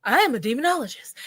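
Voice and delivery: pompous put-on voice; mock self-important voice. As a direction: parody a proud self-proclaimed expert. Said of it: "they were like".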